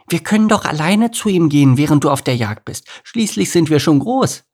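put-on high, loud little-girl argument; high kid voice, pushing back; insistent lion cub. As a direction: insist like a child who feels grown up. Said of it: "behauptete Laila".

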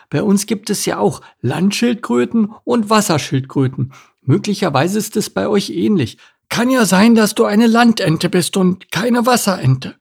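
high-voiced, careful turtle character; high turtle voice, agreeing and advising; cautious turtle.

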